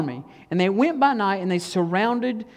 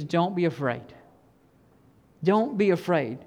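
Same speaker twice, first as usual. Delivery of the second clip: quiet, lower-pitched calm reassurance; calm response; 3 semitones lower, 4 dB quieter than usual. Acted. Elisha calmly answers his panicked servant, quiet and reassuring. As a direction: say it softly and evenly, leaving room between phrases. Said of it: "gives this calm response".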